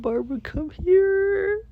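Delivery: squealed, very high wailing plea; mock crying. Begging the lost cat to return, hammed-up bawling. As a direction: bawl for the cat, stretched and loud. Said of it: "I start crying".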